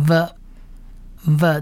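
"v" as an isolated drawn-out sound; letter-sound demo. Shown as a demonstration. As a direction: give "v" slowly on its own. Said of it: "念v". (示范字母“v”的发音)

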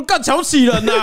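high, half-laughing indignant cry; laughing mock outrage. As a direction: protest through laughter. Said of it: "有点小怒".

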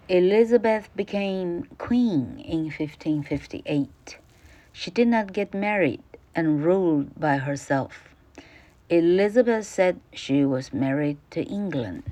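careful, clear classroom reading; reading the passage aloud to students; textbook read-aloud. Acted. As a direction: read it out for the pupils. Teacher reads English textbook.

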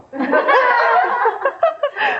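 high burst of laughter; real high laugh. Real, not acted. Laughter after punchline.

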